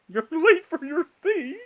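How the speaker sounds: hammed-up Hatter voice; Mad Hatter voice. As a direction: draw it out like a dotty Hatter. Hatter voice, laughing.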